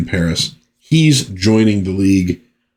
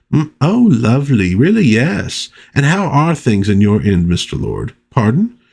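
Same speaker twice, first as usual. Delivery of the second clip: Sybil Fawlty impression; 2 semitones higher than usual. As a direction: play the chatty hotel wife cooing down the line. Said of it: "You could really picture the lines coming out of Prunella Scales".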